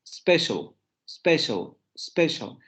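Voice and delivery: special said as one English word starting on S; S-initial pronunciation. Shown as a demonstration. Teacher repeating the English word, S-initial pronunciation. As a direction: say special with nothing before the S. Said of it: "la primera sílaba empieza con la S".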